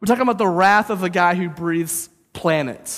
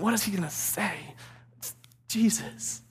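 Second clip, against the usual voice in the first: soft, breathless half-whisper; hushed aside; 3 semitones higher, 8 dB quieter than usual. Acted. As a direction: let the answer slip out quietly. Voicing the listener's thought, hushed.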